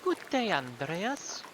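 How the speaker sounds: put-on high female-character voice with a light German accent; slow greeting, lifting high in a mild German accent; vaguely German accent. Villager offering Andreas a plate, vaguely German accent. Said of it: "how to do a vaguely German accent".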